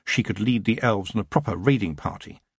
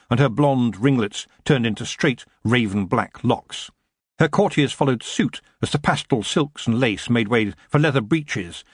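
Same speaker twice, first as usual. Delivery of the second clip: ordinary delivery, nothing marked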